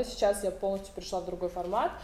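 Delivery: usual speaking voice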